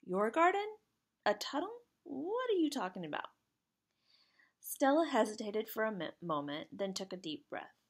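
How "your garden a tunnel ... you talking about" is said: high-pitched, puzzled questions; confused. A narrator's reading. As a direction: ask in bewilderment, voice pitched right up. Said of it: "Jack looked confused".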